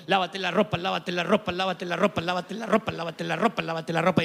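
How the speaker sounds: droning rapid-fire loop, like goose honks; nagging parent repetition. Retold or imitated. Parent telling the child over and over, droning nag. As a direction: repeat it monotonously without a break. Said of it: "Así como le dice a tu hijo".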